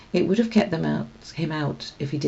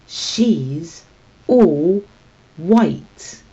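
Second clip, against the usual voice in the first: dramatic dread; 6 dB louder, much slower than usual. Acted. Tam reveals dreaded secret.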